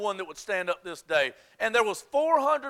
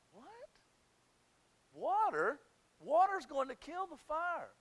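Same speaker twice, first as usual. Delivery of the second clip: hushed, high-pitched puzzlement; incredulous onlookers, voiced quietly; baffled disbelief; 6 semitones higher, 10 dB quieter than usual. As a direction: question it softly, as if it makes no sense. Bystanders doubt Elijah's order.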